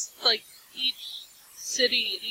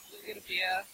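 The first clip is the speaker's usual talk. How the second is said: lower-pitched Boston accent; put-on Boston accent; 4 semitones lower, faster than usual. Shown as a demonstration. Minnesotan showing co-hosts her Boston side, put-on Boston accent. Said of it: "I could put on the Boston".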